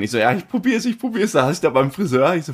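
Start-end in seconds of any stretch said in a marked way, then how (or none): 0.2-1.3 high, hurried "I'm trying"; eager retold reply. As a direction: rush it out, pitched up. Retold hurried answer to police.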